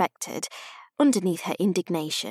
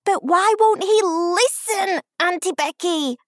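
put-on exasperated small child; little girl complaining; 10 semitones higher, 5 dB louder than usual. A narrator's reading. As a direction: whine the question like a frustrated five-year-old. Small child questions her aunt.

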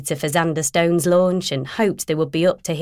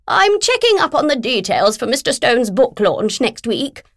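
higher, brisk voice for a pushy agent on the phone; brisk, pushy, higher-pitched businesswoman; bossy literary agent; 6 semitones higher, 3 dB louder than usual. Acted. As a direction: play the bulldozing agent who won't wait.

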